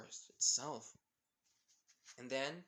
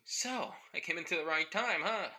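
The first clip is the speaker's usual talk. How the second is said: put-on cocky boy voice; 5 semitones higher, 6 dB louder than usual. New boy voice arrives.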